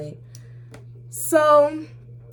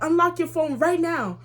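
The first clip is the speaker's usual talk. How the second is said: demanding mom; 8 semitones higher than usual. Retold or imitated. Acting mom demanding the phone.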